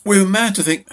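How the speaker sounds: rising panicked cry; voice risen in panic. A narrator's reading. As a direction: let the voice climb as panic takes her. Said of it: "her voice had risen a pitch".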